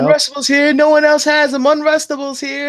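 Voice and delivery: high-pitched hawker's yell; imitated yelling. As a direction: call it out loudly across the room. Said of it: "screaming and yelling across at each other".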